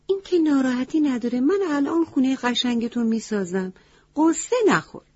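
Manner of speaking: high, quick, perky little crow voice; cheerful crow. Acted. Crow tells the crying elephant not to worry, high and perky. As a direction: chirp it brightly to cheer him up.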